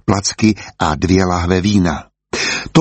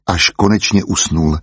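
ordinary delivery, nothing marked

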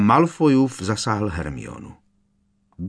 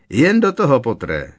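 high, louder jeer on do, cool taunt; cold-blooded goading; 4 semitones higher, 7 dB louder than usual. A narrator's reading. Malfoy egging Potter on to duel, cold taunting. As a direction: goad him coolly into fighting. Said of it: "popichoval ho chladnokrevně Malfoy".

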